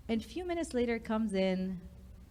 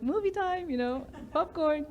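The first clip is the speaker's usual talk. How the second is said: excited; 7 semitones higher than usual. Retold or imitated. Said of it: "I'll be like".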